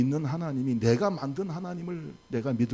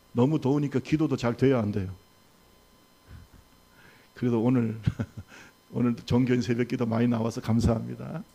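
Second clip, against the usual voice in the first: ordinary talk, nothing marked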